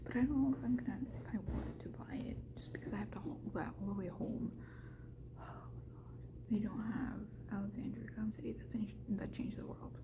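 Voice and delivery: hushed whisper. Real, not acted. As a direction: keep it to a whisper.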